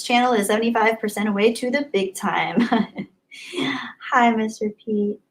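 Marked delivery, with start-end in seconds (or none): none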